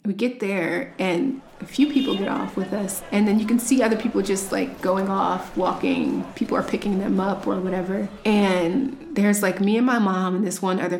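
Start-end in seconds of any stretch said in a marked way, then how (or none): none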